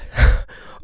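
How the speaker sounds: a burst of breathy laughter; astonished laugh; surprised laugh. Real, not acted. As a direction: laugh out in sudden disbelief. Sudden realization, laughing at God.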